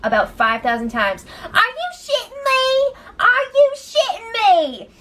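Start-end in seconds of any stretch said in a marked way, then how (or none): 1.5-4.9 higher, slow put-on outrage; mock screaming. Raven yelling at Jess, slow high mock outrage. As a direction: drag it out in disbelief, hammed-up. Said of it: "She's screaming about Jess".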